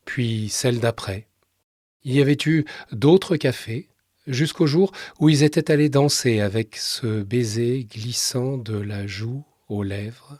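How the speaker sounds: measured literary reading with long pauses; subdued radio storyteller, letting each sentence settle; story narration. A narrator's reading. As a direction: read the story in a calm, restrained voice. Reads a short story aloud.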